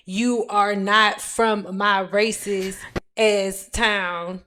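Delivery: reading a comment out slowly, word by word; measured, low read with a pause before "town"; slow, halting read. Retold or imitated. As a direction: spell it out deliberately, dragging each word. Puzzling out a typed comment.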